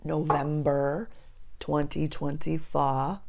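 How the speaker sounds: slow, deliberate "November twenty twenty-four"; careful re-take. Real, not acted. Correcting her accent slip for viewers, slow careful re-take. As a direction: say each word of the date slowly and carefully. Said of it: "I don't know why my accent just did that".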